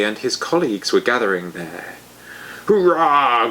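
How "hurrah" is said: a loud, higher groaned cheer; groaning trolls. A narrator's reading. Trolls and ogres groaning a cheer at the party. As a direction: cheer like a troll pelting stones. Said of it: "groaned the trolls and the ogres".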